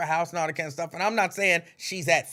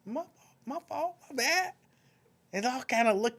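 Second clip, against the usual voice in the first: sheepish apology; 9 semitones higher than usual. Real, not acted. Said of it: "Tanya, not Tanya".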